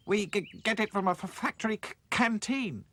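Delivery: high voice, stammering on each word; stammering doll. Acted. Hi-Fi stammering an answer to the mouse. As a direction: trip over the first sounds. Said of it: "Hi-Fi told him".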